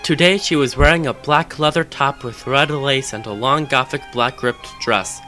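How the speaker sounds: plain reading voice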